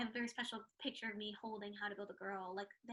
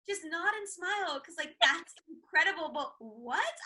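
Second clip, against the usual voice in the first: overwhelmed; 5 semitones higher, 8 dB louder, slower than usual. Retold or imitated. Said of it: "I was like".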